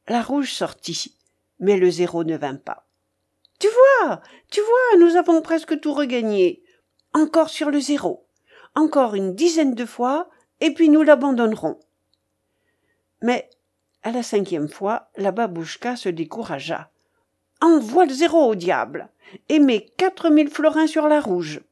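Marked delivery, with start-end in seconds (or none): 3.6-11.8 high, loud, excited crowing; old gambler, triumphant. Acted. Babouchka boasts to her companion after winning, triumphant. 17.6-21.6 raised, forceful order; imperious old gambler, fed up. Acted. Babouchka gives up on zero and orders, exasperated. Voices discouraged, impatient babouchka.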